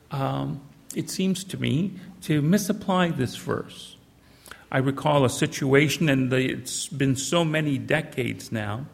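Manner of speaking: ordinary delivery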